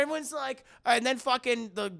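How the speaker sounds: ordinary delivery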